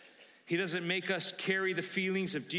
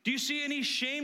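ordinary talk, nothing marked

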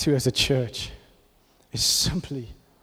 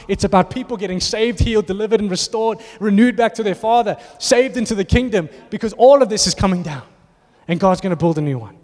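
climbing higher and louder word by word; building sermon list; 4 semitones higher, 4 dB louder than usual. Acted. Preacher rallying the church on salvation, building preaching. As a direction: stack each word, building to a peak. Rising list of kingdom works.

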